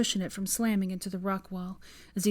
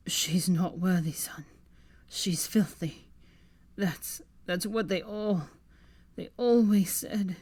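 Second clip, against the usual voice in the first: slurred; slower than usual. Acted. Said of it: "slurring slightly".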